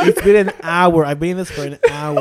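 loud, exasperated mock wail; near-tears wail. Teller reliving an hour held at border, tearfully exasperated. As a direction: plead as if about to cry. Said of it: "You're ready to cry at this point".

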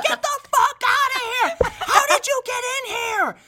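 very high, loud screamed imitation; shrieking. Man retelling girlfriend ordering ex out of room, shrieking. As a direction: screech to drive an intruder out. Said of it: "absolutely fucking shrieking".

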